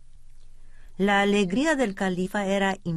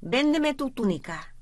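angry boss; 7 semitones higher than usual. A narrator's reading. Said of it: "gritó el jefe".

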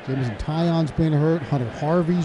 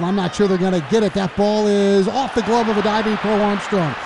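excited play-by-play; 7 semitones higher than usual. Real, not acted. Radio announcer to listeners, calling the messy play, excited rising shout. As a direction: let it build into a near-shout as the runners get caught. Live call of botched double play.

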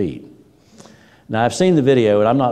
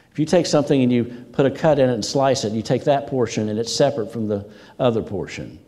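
ordinary delivery

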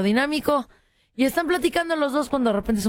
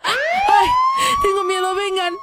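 shrill fake cry of fear, much higher and louder; faked terror; 4 dB louder, slower than usual. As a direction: plead like a faking damsel in distress. Fake distress to police on phone.

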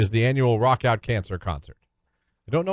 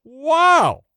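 put-on high, gee-whiz kid voice; wide-eyed old-timey kid amazement; wholesome 1950s kid; 9 semitones higher, much slower than usual. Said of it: "Did you just say wowie?".

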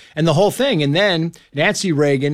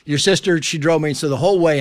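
ordinary talk, nothing marked

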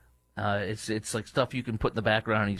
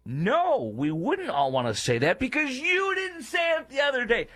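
high, shouted rebuttal; a shouted retort at a cowardly senator; angry retort; 12 semitones higher than usual. Real, not acted. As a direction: shout him down, outraged.

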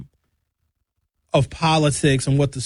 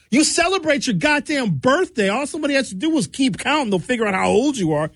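high, incredulous rant; exasperated high rant; 10 semitones higher than usual. Real, not acted. Comedian ranting to the crowd, high and exasperated. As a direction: pitch it up in disbelief at the silliness.

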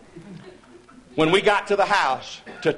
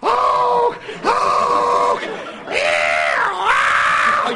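frantic cries; much slower than usual. Retold or imitated. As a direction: cry out in the dark ruckus. Cries in the dark ruckus.

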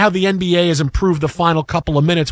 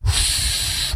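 blowing air out hard; exasperated exhale; 7 dB quieter than usual. Retold or imitated. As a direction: puff out frustrated air. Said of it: "he goes".

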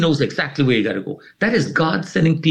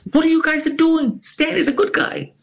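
much higher, urgent call across the lobby. Retold or imitated. Boss calling out to the guards, high urgent call. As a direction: cut in high and urgent to stop them.

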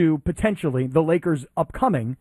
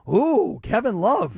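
higher-pitched pretend fan thrill; mock excitement; 5 semitones higher, much slower than usual. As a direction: act excited, clearly not meaning it. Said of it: "gets me excited where I go".

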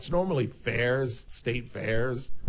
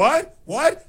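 high, loud panicked "What? What?"; panicked rider; 11 dB louder than usual. Retold or imitated. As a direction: yell it in sudden alarm as the ride takes off. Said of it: "And then he's like".

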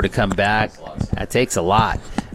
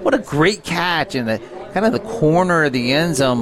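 high-pitched thrill at a big catch; excited reaction; 6 semitones higher than usual. Real, not acted. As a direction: marvel at the play, pitched well up. Analyst reacts to the touchdown.

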